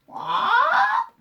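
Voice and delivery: very high loud squawk; parrot squawk. A narrator's reading. Parrot at the vet squawking. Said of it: "the parrot began to squawk".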